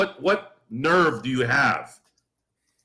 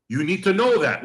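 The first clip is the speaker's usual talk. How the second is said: normal talking voice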